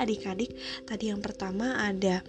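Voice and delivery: plain reading voice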